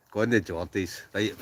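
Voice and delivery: ordinary talk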